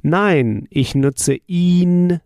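ordinary talk, nothing marked

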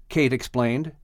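usual speaking voice

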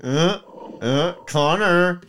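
mock frustrated mom holding back a curse; imitated exasperated mother. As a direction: groan the name in put-on exasperation. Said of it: "so she's just like".

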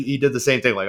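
ordinary delivery

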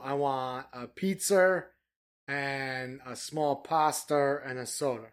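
slow Boston demo, R added to final A's; Boston intrusive R. Shown as a demonstration. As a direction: spell out the Boston "pizzer" slowly. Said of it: "that dropped R comes back onto it".

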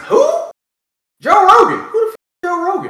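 high, loud incredulous who; baffled who. Acted. To friends asking about Joe Rogan, a high baffled disbelief. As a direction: sound like you've truly never heard of him.

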